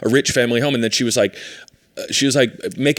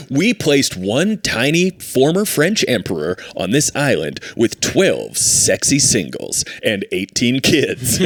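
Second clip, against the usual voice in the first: slow, drawn-out mock TV announcer; measured, swooping promo voice-over; reality show narrator; slower than usual. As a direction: tease the premise like a dating-show host.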